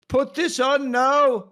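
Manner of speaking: loud, slow, drawn-out wooden line reading; mock a terrible non-actress delivering her line; bad actress. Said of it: "acts like she isn't even an actress".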